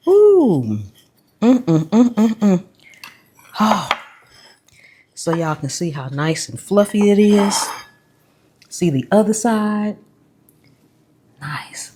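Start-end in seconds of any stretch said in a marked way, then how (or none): 0.1-2.6 savoring hum. Real, not acted. Tasting, savoring. 11.4-12.0 whisper. Real, not acted. Admiring the biscuit.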